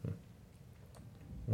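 ordinary delivery

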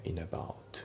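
"インアバウト" softly joined as "inabout"; linked pronunciation. Shown as a demonstration. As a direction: carry N into A in "インアバウト". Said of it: "リンキングで".

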